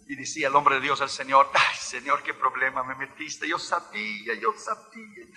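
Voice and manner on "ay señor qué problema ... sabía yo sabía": very soft, worried aside; hushed lament to God. Acted. Man of God before the Russian soldier, quietly lamenting to the Lord. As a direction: lament under the breath.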